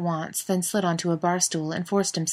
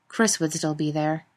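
ordinary delivery, nothing marked

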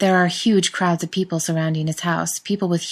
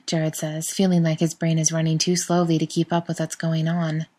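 ordinary delivery

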